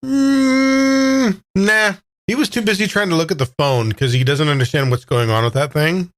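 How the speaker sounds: usual speaking voice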